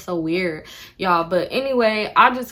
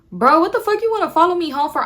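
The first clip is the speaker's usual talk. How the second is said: higher, louder mock yell; exasperated yell; 9 semitones higher, 5 dB louder than usual. Yelling at the guy who followed her home. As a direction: throw the question at him.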